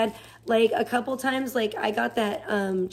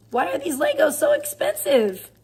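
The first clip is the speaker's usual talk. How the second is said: much higher, put-on whiny voice; squeaky mock complaint; 11 semitones higher than usual. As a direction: pitch it way up like a kid whining about prices. Whiny acted complaint about Lego prices.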